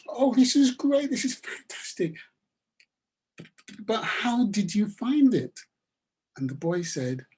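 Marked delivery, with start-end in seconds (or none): 0.1-5.6 high, bright burst of joy; overjoyed. A narrator's reading. Farmer overjoyed at the watch.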